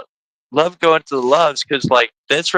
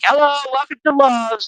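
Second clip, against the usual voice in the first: higher chirpy cashier greeting; greeting clerk; 11 semitones higher than usual. Retold or imitated. Host imitating Love's cashiers greeting customers, higher chirpy voice. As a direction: sing out the store greeting. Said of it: "they're like".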